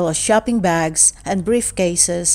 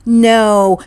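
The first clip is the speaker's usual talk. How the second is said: firm loud no; 2 semitones higher, 8 dB louder than usual. Acted. Floyd refuses flatly.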